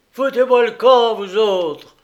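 loud, high threatening order; menacing. A narrator's reading. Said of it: "d'un air menaçant".

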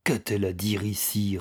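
plain reading voice

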